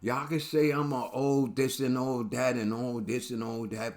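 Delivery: ordinary talk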